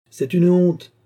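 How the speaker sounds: loud, high indignant outcry; indignant shout. Acted. Drunken farmer Signoret shouting about the king's ban, indignant shout. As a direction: cry out in outrage at the shame. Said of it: "criait-il à pleine fête".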